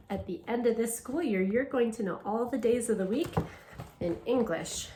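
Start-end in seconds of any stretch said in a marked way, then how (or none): none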